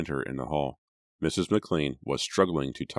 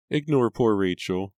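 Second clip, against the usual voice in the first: through tears; 6 semitones higher, 5 dB louder than usual. A narrator's reading. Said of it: "through her tears".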